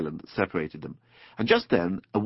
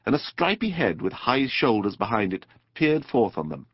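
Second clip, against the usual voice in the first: ordinary delivery, nothing marked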